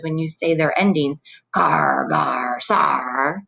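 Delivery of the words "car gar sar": low, drawn-out pirate growl on each ending. Shown as a demonstration. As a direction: draw each syllable out like a pirate. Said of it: "you sound like a pirate".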